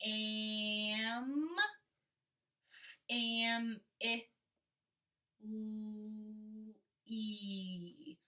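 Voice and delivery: family stretched sound by sound, soft. Shown as a demonstration. Teacher sounding out family for kindergartners, slow and soft. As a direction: sound out "Am, am, I, L-, E" slowly. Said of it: "sound out the word family".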